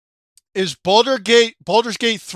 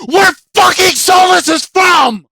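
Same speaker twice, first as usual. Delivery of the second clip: exasperated shout; 6 semitones higher, 3 dB louder than usual. Real, not acted. Ranting about the story.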